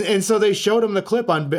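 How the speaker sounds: ordinary delivery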